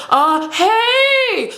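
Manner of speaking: high, drawn-out awkward "hey"; awkward calling out. Retold or imitated. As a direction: call out to someone, unsure what to say. Acts awkward greeting to victim.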